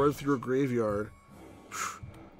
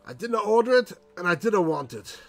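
loud, high grumpy yokel; grumpy farmer voice; 6 semitones higher, 5 dB louder than usual. Acted. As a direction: shout them off the doorstep like a cranky farmer. Grumpy refusal at the door.

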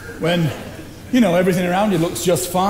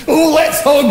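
high, loud, laughing burst of glee; eager hug offer; 5 semitones higher, 5 dB louder, slower than usual. Acted. Offering his friend a hug after making peace, gleeful and laughing. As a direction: throw your arms open with a laugh.

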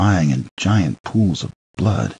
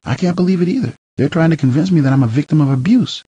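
much higher voice, indignant disbelief; wife's voice; 10 semitones higher than usual. Acted. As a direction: voice the wife, incredulous. Nicole's voice in dialogue.

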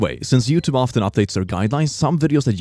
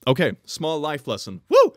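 ordinary talk, nothing marked

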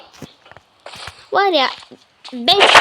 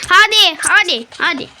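impatient urging; 5 semitones higher, 6 dB louder than usual. Real, not acted.

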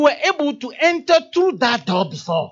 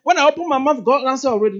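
bragging; 4 semitones higher, 6 dB louder than usual. Retold or imitated. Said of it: "You don't brag and say".

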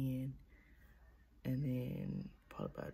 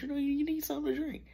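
quoted inner voice; 12 semitones higher, 6 dB louder, much faster than usual. Retold or imitated. Quoting an inner voice, high fast.

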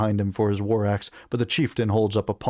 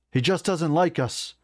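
raised-pitch call across a fight; calling back; 8 semitones higher than usual. A narrator's reading. Narrator answering Clara mid-fight, calling back. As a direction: pitch it up to carry to a companion. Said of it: "I call back".

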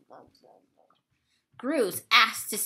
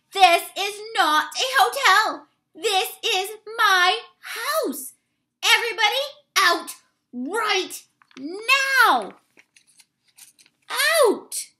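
much higher and louder, slowing; furious bear roar; 8 semitones higher, 6 dB louder than usual. Acted. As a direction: throw everyone out of his house. Bruce loses his temper.

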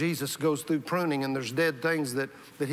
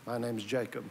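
softer, barely above a breath; whispered confession; 3 semitones lower, 6 dB quieter than usual. Retold or imitated. Jacob whispering his name to the angel, ashamed. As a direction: admit your name in shame. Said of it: "And he whispers and says".

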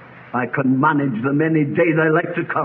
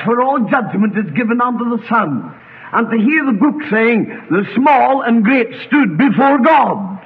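raised, ringing sermon delivery; impassioned preaching; 6 semitones higher, 3 dB louder than usual. Real, not acted. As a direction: preach it forcefully, with weight on each phrase. Preacher building in full flow.

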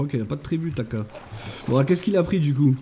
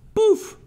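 squeaky high sound effect; high pouf. Real, not acted. Streamer voicing a pop as the lock opens, high.

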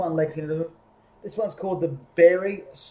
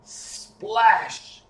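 splash; 6 semitones higher, 5 dB louder than usual. A narrator's reading. Sound word in story.

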